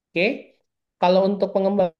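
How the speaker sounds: choppy, cutting-out audio; radio breaking up. Real, not acted. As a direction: voice dropping out on a bad line. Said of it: "suaranya putus-putus". (suara putus-putus)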